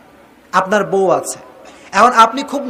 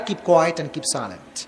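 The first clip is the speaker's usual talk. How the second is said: very slow, softer advice; 5 semitones lower, 7 dB quieter, much slower than usual. Shown as a demonstration. Preacher telling wives to stay silent, slow and soft. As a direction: spell it out slowly, hushed. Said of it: "advice to wives".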